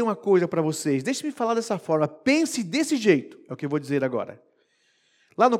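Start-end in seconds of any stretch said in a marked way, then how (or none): 2.1-3.4 lento e enfático. Acted. Pregador enfatiza frase.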